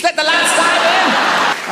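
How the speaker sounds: high, loud laddish yell; rowdy shout. Acted. Mate rallying his friends, a high roaring shout. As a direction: whip up the lads with a roar.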